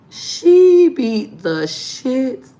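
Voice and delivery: She beat the shit drawn out slow and loud, each word hit; slow emphatic stress. Real, not acted. Stressing how hard the girl beat her. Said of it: "That was the worst".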